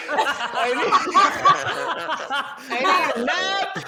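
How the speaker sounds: laughing. Real, not acted. Laughing while talking.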